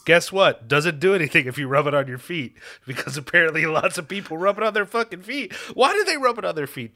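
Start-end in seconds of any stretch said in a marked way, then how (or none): 2.9-7.0 high-pitched, baffled rant; incredulous. Real, not acted. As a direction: demand an explanation, pitch climbing. Baffled by absurd search result.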